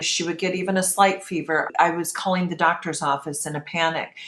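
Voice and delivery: normal talking voice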